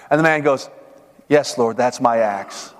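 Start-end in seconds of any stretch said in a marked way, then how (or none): 1.3-2.7 slow, low, resigned yes; humble admission. Acted. Man tells the Lord the old axe is his, resigned. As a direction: own up slowly and humbly.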